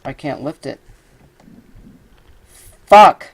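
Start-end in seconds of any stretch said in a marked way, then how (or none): 2.9-3.3 a sudden loud, high curse; real outburst of rage; furious shout. Real, not acted. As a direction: explode at the game. Fails to lift object again.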